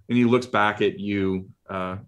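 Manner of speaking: normal talking voice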